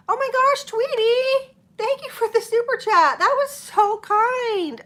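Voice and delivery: high, loud, gushing thank-you; excited thanks. Real, not acted. Host thanking Tweety for a super chat, high and gushing. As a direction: squeal your thanks to the viewer.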